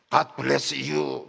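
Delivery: slow, drawn-out mock English phrase. Pastor mocking English-speaking evangelists, slow exaggerated English. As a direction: parody a showy English blessing. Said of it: "뭐 이렇게 해야".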